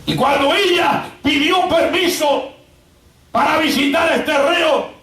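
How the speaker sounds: forceful pulpit storytelling; full-force preaching. Acted. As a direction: preach it out in driven, ringing phrases.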